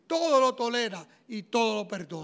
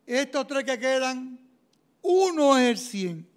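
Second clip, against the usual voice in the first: loud and slow reveal; dramatic announcement; 3 semitones higher, 9 dB louder than usual. Retold or imitated. The examiner revealing the top score, loud and slow. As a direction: build suspense as you announce.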